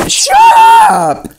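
sudden very high squeal; mock yell. Dog character shouting at owner, very high squeal. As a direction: squeal it out in play.

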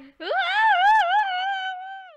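squeaky high laugh burst; high squealing laugh. Real, not acted. Reacting to the voice crack.

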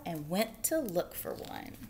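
usual speaking voice

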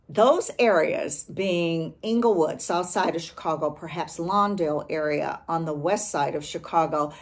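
ordinary delivery

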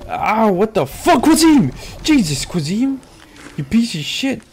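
ow-then-cursing outburst, real; pained angry yell. Real, not acted. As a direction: yell at him for hurting you. Hit by teammate, yelling.

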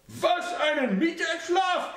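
put-on high old mother snapping at her son; snapping at him. A narrator's reading. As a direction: bark at him in disbelief. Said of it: "herrschte sie ihn an".

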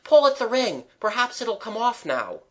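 high, urgent, mildly winded; barely enough breath. A narrator's reading. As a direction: blurt it out loud at first, short of breath. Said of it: "Gerald had just breath to say".

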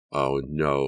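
ordinary delivery

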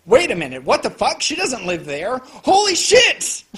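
retold stunned outburst; shocked realization. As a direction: build from confusion to shock. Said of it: "I was like".

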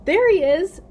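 high, very loud cry of discovery; excited shout. A narrator's reading. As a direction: shout it out on spotting him. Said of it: "shouted Lucy".

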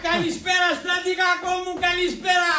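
put-on high, sing-song drunken hello; tipsy high-pitched call; blind-drunk greeting. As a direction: hail an old pal, merrily plastered. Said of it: "είναι τύφλα μεθυσμένος".

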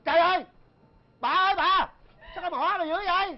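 father yelling in horror at his daughter's lips; shocked shout. Acted. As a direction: yell it in horror at her lips.

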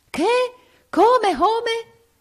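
high, loud shocked cry; the king bursts out in disbelief; shocked yell. A narrator's reading. King hears the girl is pregnant, yells in shock. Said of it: "urlò il re".

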